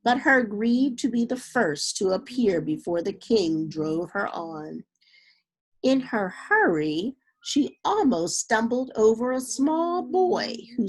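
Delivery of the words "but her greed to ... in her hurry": plain reading voice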